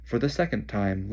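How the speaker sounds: ordinary delivery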